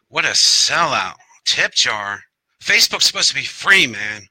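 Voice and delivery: high-pitched, playful heckling; mock outrage. Poet mimics viewers scolding him over tip jar, mock outrage. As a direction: play a viewer loudly griping in jest.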